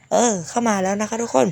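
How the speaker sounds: ordinary talk, nothing marked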